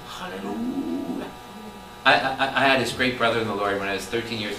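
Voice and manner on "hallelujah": soft hallelujah. Shown as a demonstration. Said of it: "can you say hallelujah?".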